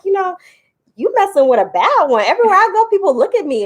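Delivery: boastful high-pitched brag; cocky bragging. Retold or imitated. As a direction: brag like you're hot stuff. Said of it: "I was like".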